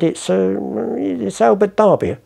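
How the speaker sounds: hesitant, high-pitched expert's verdict; auction expert. Acted. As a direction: imitate an expert hedging over an attribution. Voices the auction expert pronouncing.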